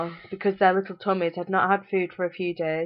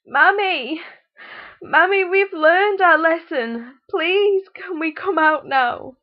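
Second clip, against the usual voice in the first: put-on high, loud child's plea; calling out; 11 semitones higher, 8 dB louder, slower than usual. A narrator's reading. Locked-in children calling out to their mother, pleading. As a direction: call out begging from the cupboard. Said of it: "tried calling out".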